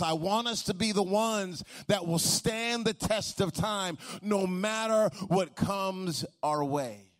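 rally the church to keep going; preaching at full force. Acted. Preaching climax to congregation.

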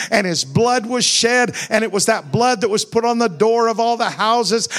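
loud, high preaching build; preaching at full force. Real, not acted. Preacher urging the congregation, loud and high. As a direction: thunder it to the congregation.

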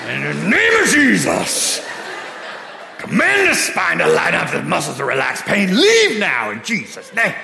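booming faith-healer imitation; forceful healing prayer. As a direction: command it like a revival healer. Friend prays forcefully for healing.

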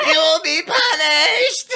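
very high, slow, laughing shout; high-pitched preacher boy. Retold or imitated. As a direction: screech it, breaking into laughter. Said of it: "It's like that high-pitched fucking".

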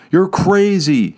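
high, slow call across the water; shouted. A narrator's reading. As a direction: call out to her on shore. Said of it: "he shouted".

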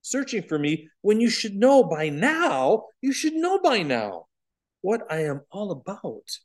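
a hint of the boy Jesus, puzzled by their worry; gently surprised Jesus. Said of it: "and said".

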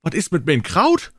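high, loud cry; shrieking wives. Acted. Wives shrieking about the sauerkraut, high and loud. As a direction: shriek like frantic housewives. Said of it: "schrien all die Frauen".

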